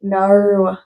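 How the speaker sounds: "no" with a long, bent Australian vowel; playful Australian "no". Pretend. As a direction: try "no" in an Australian accent. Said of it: "I like saying no".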